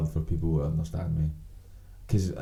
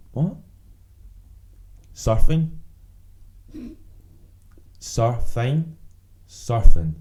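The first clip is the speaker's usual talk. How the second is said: loud incredulous echo; baffled repeating; 6 semitones higher, 9 dB louder, much slower than usual. Retold or imitated. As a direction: repeat it like you can't believe it. Mimicking confused repetition.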